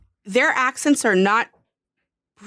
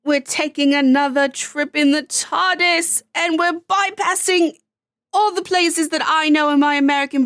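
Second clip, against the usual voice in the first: low-budget Helen Mirren; 3 semitones higher than usual. Acted. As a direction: do a cheap British grande-dame accent. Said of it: "You sounded like an extremely low-budget Helen Mirren".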